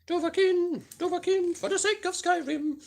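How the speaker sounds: very high, far-off heroic chant; hammed-up Skyrim Dragonborn chant; epic Dovahkiin call. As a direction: belt it like a meme battle cry. Skyrim meme shout.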